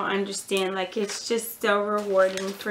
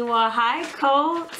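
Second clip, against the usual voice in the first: high excited greeting; 5 semitones higher, 4 dB louder, slower than usual. Real, not acted. Greeting a toddler viewer, high and excited. Greeting a two-year-old fan.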